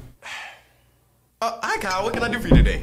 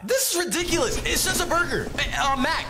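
exasperated protest; 9 semitones higher than usual. Acted. Customer protesting the burger.

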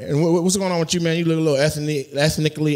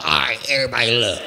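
quick, lower mocking mimicry; imitating audience member; 5 semitones lower, faster than usual. Comic playing the man at his YMCA job, mocking imitation. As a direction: mimic him addressing the kids, fast and low. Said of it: "'Cause he be like".

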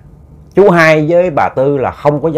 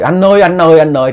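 louder, higher mock cry out; 3 semitones higher, 3 dB louder than usual. Wife wakes from the nightmare calling her husband, crying out. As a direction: call for the husband in panic. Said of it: "thím la lên quá trời".

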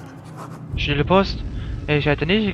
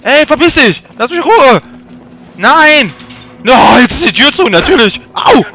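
panicked angry yelling; 9 semitones higher, 14 dB louder than usual. Real, not acted. Player yells at the game attacker to leave, panicked shouting. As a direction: shout high and very loud.

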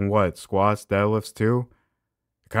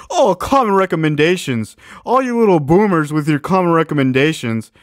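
louder, higher sneer at "common recommendations"; sneering mockery; 7 semitones higher, 8 dB louder than usual. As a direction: jeer at the boomers' advice. Mocking the video's phrase.

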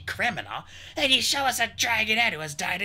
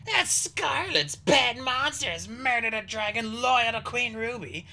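higher-pitched hostile dragon voice; snarling disbelief; 10 semitones higher than usual. A narrator's reading. Skywing argues that Peril murdered the soldier, snarling. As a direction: sneer through the unlikely alternative. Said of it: "he snarled".

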